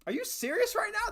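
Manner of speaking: can't believe what chat just said; shocked disbelief. Real, not acted. Streamer told of a curse in Egypt, shocked and disbelieving.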